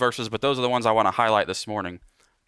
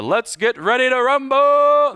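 booming, high, drawn-out ring announcer call; Michael Buffer impression; 14 semitones higher, 8 dB louder, slower than usual. Preacher hyping the congregation like the boxing announcer, booming and drawn out. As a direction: stretch it out like a fight-night announcer. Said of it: "that guy".

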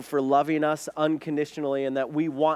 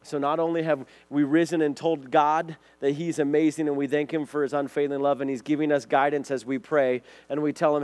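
louder, driving preacher's push; preaching at full force; 5 dB louder than usual. Real, not acted. Preacher rallying his congregation, loud and driving. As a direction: press the point home to the congregation.

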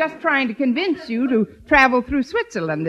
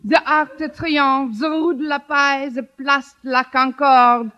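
put-on French accent. Traveller praising Paris in a hammed-up French accent. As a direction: play a hammy Frenchman. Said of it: "trying to represent France".

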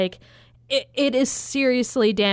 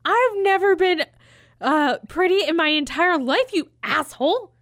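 angry heroine; 7 semitones higher, 3 dB louder than usual. Retold or imitated. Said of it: "she's like".

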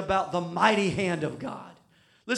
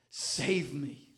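low, soft plea; quiet prayer; 7 semitones lower, 7 dB quieter than usual. Shown as a demonstration. Preacher showing the congregation the prayer, softly. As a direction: pray it under the breath. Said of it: "You ever prayed that prayer?".

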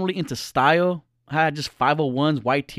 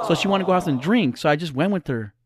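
usual speaking voice

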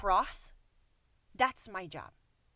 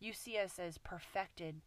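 prayer; 4 semitones lower than usual. Acted. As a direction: pray gently and sincerely to the Father. Closing prayer.

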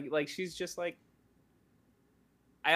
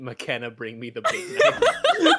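mock order; 10 dB louder, slower than usual. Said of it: "came very close to tweeting out".

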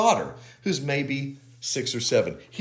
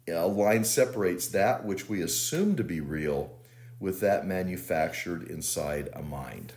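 lower, measured narrator read; intro narration; 5 semitones lower, 4 dB quieter than usual. Acted. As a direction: read it as a solemn show introduction. Reads the episode's intro.

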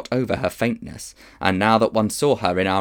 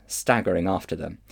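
usual speaking voice

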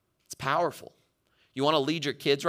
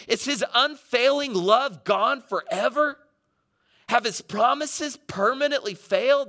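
high, insistent cry to God; acted, drawn-out plea; anguished questioning; 11 semitones higher, 4 dB louder, slower than usual. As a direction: push each question high and loud. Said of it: "get into the real questions".

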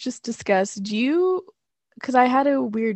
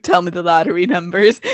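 mock demand; 3 semitones lower, 8 dB louder than usual.

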